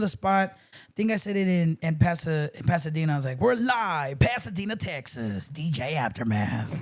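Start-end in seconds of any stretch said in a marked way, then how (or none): 3.4-6.8 low, slow, rasped on-air intro; raspy radio announcer voice. Shown as a demonstration. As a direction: roll out the deep raspy DJ drop. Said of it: "the [name] voice".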